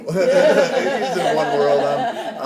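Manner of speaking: slow, loud, laughing through the words. Real, not acted. Laughing at himself while comparing himself to Depp. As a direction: let the laugh carry the line.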